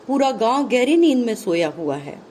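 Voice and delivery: ordinary talk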